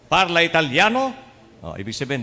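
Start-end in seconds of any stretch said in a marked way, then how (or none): 0.1-1.1 loud, high Italian challenge; brusque monsignor. Retold or imitated. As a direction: demand it like a grumpy Vatican monsignor. Grumpy monsignor challenges the seminarian. (pasigaw na tanong ng masungit na monsignor)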